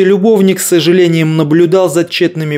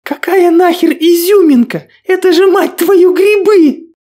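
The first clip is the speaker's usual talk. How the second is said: shrieking in horror. Acted. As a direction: squeal it, beside herself with fear. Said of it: "Голос девушки перешёл в визг".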